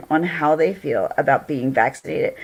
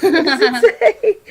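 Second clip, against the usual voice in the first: higher, giggly question; laughing; 9 semitones higher, 3 dB louder than usual. Real, not acted. Streamer trying to read a viewer's message, laughing. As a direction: ask it through real laughter.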